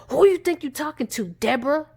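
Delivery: loud, fast, high retold outburst; deranged snap. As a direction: act out a man suddenly switching on her. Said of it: "he looked like this crazy, deranged nut".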